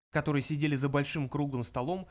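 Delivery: usual speaking voice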